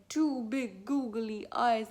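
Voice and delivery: slow, loud, wondering read; deliberate, building suspense; suspenseful storybook reveal. Shown as a demonstration. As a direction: draw out each word for a child.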